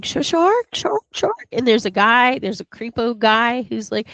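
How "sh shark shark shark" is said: high mock scream, stuttered start; girls screaming shark. Girls on the beach screaming, high mock squeal. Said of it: "girls continually screaming".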